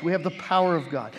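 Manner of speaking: ordinary talk, nothing marked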